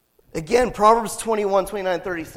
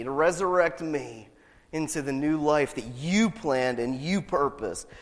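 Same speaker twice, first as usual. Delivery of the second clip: lowered, measured praying voice; earnest prayer; 5 semitones lower than usual. Acted. Preacher leading the congregation in repentance, subdued prayer. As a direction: pray it with pauses between phrases.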